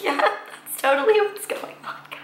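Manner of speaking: very high, squeaky sarcastic singsong; mock agreement. Teen sarcastically agrees with the nurse's misgendering in a squeaky, high-pitched voice. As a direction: chirp it out in bitter mock cheer.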